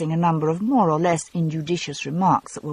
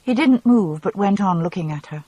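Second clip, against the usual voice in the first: ordinary delivery, nothing marked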